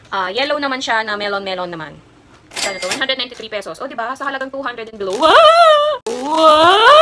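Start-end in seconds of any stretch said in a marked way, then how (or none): 5.1-7.0 high, loud squeal of delight; delighted shriek; excited wow. Real, not acted. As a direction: gush over the cute outfit. Excited reaction to baby clothes.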